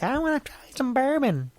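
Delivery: very high-pitched mock child voice; imitated kid. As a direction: squeak it like an eager little kid. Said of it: "I don't want your kids to be like".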